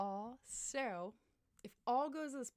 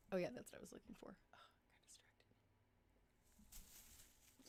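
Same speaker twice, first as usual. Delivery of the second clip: whisper; 12 dB quieter than usual. Real, not acted. Muttering to herself.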